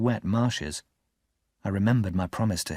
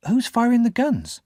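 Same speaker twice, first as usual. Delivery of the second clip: put-on child's question; 12 semitones higher than usual. Pip asks.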